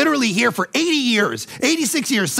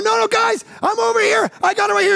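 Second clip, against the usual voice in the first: God calling out; 14 semitones higher, faster than usual. Retold or imitated. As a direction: wave them over, shouting. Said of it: "he's over here going".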